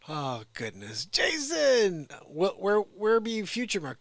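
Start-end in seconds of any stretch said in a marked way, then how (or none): 1.0-4.0 loud, much higher call across the room; a loud call to Jason asking where Future Mark is. Real, not acted. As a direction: shout for Jason. Calling out to Jason.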